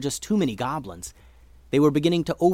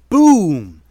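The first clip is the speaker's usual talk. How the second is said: loud, stretched-out BOOM; big dramatic storybook boom; booming exclamation; 6 semitones higher, 14 dB louder than usual. A narrator's reading. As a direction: make the word explode, high and drawn out. Narrator acts out the boom.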